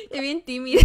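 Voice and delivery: loud laugh around the words; laughing loudly. Real, not acted. Streamer teases the shy girl, loud laughter. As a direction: burst out laughing at her shyness.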